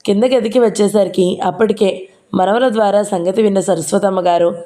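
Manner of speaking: ordinary delivery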